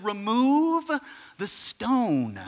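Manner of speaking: drawn-out, high "remove", then firm; stretched-out, deliberate command; solemn command. Acted. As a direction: give a slow, weighty order. Acts out Jesus's command.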